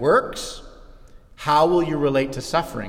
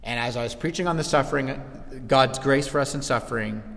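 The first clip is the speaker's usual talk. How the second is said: normal talking voice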